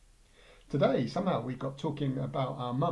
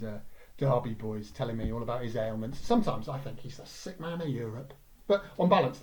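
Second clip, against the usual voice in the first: ordinary delivery, nothing marked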